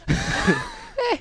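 squeaked high "Eh" in put-on voice; hammed-up impression grunt; high mock grunt. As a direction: mimic the grunt in a silly voice. Imitating Montoya sound.

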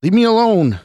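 high, loud desperate yell; angry shout. A narrator's reading. Scott pulls a knife on the woman, shouting angrily. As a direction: shout her away in anger. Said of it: "Scott shouted angrily".

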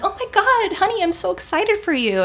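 very high, gushing delight; thrilled boss. Retold or imitated. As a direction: shriek of joy for a colleague. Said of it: "She's like".

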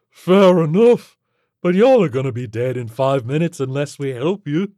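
concede, then warn them breezily; blunt alien creature. Acted.

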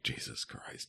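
breathy whispered Jesus Christ, barely audible; whispered mock dismay. Imagining the cast reacting, a hushed mock gasp. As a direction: mouth it under your breath in mock horror. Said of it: "anybody was like".

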